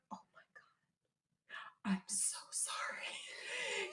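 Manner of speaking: imitated slow, hushed whisper; mortified apology. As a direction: whisper it as if dying of embarrassment. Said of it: "He was so apologetic".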